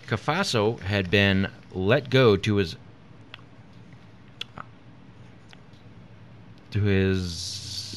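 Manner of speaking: slow read that stalls after "to his"; hesitant, stuck reading. Real, not acted. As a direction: pause long before repeating "to his", stuck on the next word. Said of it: "you were just pausing and looking at me".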